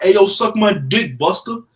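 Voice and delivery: loud, high-pitched New York heckler; New York accent. Retold or imitated. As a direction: yell it like a rowdy New York fan. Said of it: "I can't do a good New York accent".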